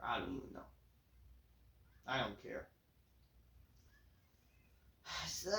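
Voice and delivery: ordinary talk, nothing marked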